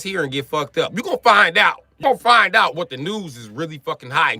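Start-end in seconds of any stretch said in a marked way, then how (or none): none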